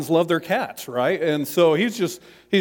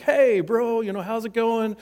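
casual bro greeting; 7 semitones higher than usual. Retold or imitated. John calling his brother.

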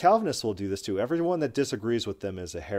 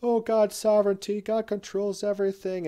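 higher, singsong mock of a preacher's slogan; mocking platitude; 11 semitones higher than usual. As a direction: parrot the pious catchphrase with scorn. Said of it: "these little platitudes like".

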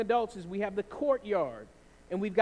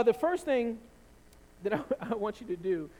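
normal talking voice

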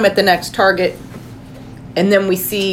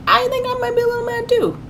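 high-pitched. Acted. Sudden high flat voice.

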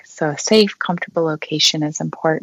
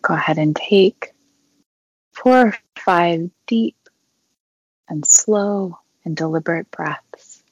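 unhurried meditation guide with long pauses; guided meditation. Acted. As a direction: guide them gently through breathing, leaving long silences.

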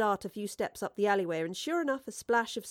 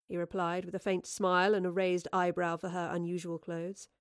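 ordinary delivery

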